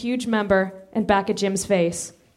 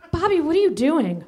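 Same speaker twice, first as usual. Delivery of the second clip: alarmed; 6 semitones higher than usual. Acted. Abe alarmed.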